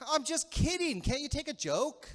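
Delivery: high-pitched mock-defensive protest; laughing it off. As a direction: brush off a cheap shot as a joke. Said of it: "you sort of laugh it off".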